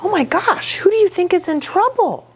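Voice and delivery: usual speaking voice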